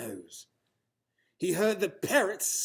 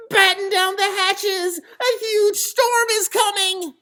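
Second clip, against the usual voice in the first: high, loud cry of alarm, much higher; a small bunny shrieking to the crew that a storm is coming; 10 dB louder than usual. Acted. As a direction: yell the warning across the deck. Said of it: "Henry cried".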